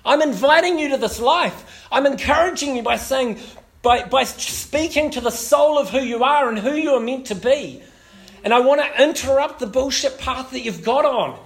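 loud, high passionate preaching; full-voiced sermon climax; preaching at full force. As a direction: preach it out to the room.

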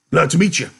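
loud, high big-man greeting; big man's voice. A narrator's reading. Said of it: "the big man said".

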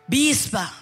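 ordinary talk, nothing marked

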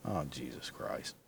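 ordinary delivery

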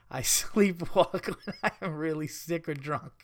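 reading through laughter; words broken up by giggles; laughing. Real, not acted. Breaks up reading the letter.

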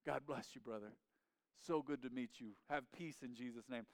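put-on low, soft thick-accented English, foreign speaker; gentle greeting in a heavy foreign accent. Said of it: "their thick English accent".

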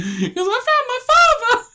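very high, slow squeal of joy; excited kid voice. Retold or imitated. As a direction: shriek it like a giddy child. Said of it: "He just comes out and goes".

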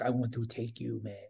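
low, soft, badly faked British accent. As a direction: murmur it in a poor British accent. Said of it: "That's my bad British accent".

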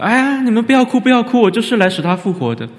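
high, loud breezy reassurance; brushing it off. Retold or imitated. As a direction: wave off the mourners cheerfully. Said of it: "没有说". (轻松地高声劝人别哭)

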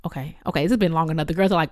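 normal talking voice

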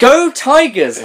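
high, drawn-out pep-rally yell; mock school cheer. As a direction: cheer it like an over-keen student.

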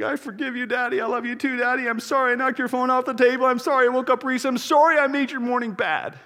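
put-on high little girl voice through tears. Four-year-old daughter forgiving her dad, high child voice.

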